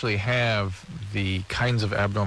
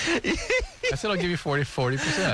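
laughing; 7 semitones higher than usual. Real, not acted. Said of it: "it did sound funny".

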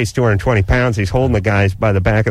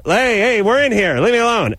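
higher, raised voice through a door; annoyed yell; 9 semitones higher than usual. Retold or imitated. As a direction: shout him off from inside the bathroom. Yelling at pounding on door.

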